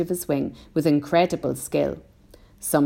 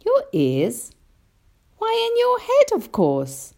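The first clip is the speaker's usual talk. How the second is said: put-on high, louder child on the answer; puzzled boy; 3 dB louder than usual. A narrator's reading. James answers the grasshopper's question, puzzled boy. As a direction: answer as if it's obvious, jumping up high.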